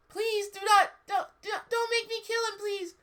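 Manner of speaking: very high squeaky begging; wrong Ebony voice. Acted. Ebony begging not to kill, misjudged high squeak. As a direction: plead in a silly falsetto. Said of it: "That's not Ebony's voice".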